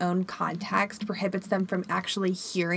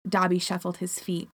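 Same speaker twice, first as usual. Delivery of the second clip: ordinary talk, nothing marked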